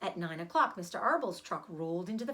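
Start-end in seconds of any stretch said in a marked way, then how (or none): none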